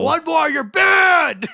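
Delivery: slow, high, loud mock yell; shouting. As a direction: bellow a threat at the chat. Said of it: "talk like that for the rest of the stream".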